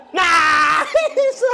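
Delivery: long, loud cheer breaking into laughter; drawn-out celebratory holler; elated shout. Real, not acted. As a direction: yell in delight at the play. Celebrates a good play.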